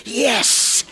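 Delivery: loud, high cry of joy; elated shout. A narrator's reading. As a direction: cheer it out, suddenly thrilled. Boy cheers as power returns.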